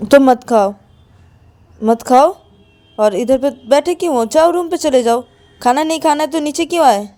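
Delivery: angry scolding. Acted. Payal scolds Ananya for refusing dinner, angrily. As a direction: snap at her to stop sulking.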